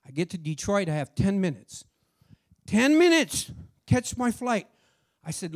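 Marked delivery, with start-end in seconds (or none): 2.6-4.7 higher, louder alarmed repeat. Real, not acted. Driver realizing he has barely any time, alarmed. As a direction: hit it like a sudden panic.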